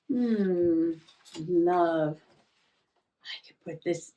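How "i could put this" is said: a private whispered thought. Real, not acted. Musing over her journal, whispered to herself. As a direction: whisper it to yourself. Thinking aloud while journaling.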